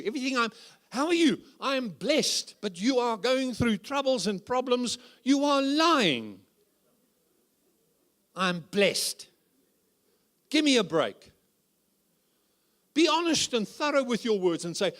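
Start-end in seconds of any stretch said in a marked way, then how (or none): 0.0-11.3 higher, sing-song parody of a glib believer; hammed-up fake-pious voice; mock pious churchgoer. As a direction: lampoon someone insisting they are blessed. Mocking fake-pious church talk.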